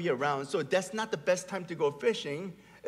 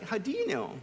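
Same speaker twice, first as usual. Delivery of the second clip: higher-pitched mock-toddler challenge; child with attitude; 5 semitones higher than usual. Three-year-old challenging his dad's answer, with attitude. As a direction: imitate a cheeky little kid doubting you. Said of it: "he says it with an attitude".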